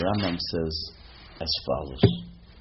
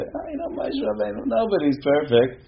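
high-pitched, airy brush-off, louder at the end; dismissive mock excuse; 4 dB louder than usual. Rabbi mimicking people who excuse their own faults, mock dismissive. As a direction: put on a high voice of glib excuse-making.

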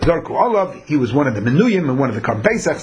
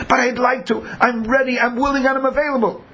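higher, insistent eager plea; eager protest; 7 semitones higher than usual. Retold or imitated. Impure man insisting to God he is ready, eager higher plea. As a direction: insist you're ready and willing.

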